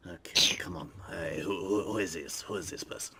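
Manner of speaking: low suspicious whisper. Acted. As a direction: whisper it, wary.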